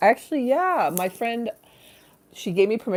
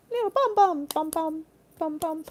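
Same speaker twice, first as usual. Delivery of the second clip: tiny, very high-pitched cutesy voice; cutesy baby talk; 12 semitones higher than usual. Pretend. Teasing about an absent friend's bottom, cutesy baby talk.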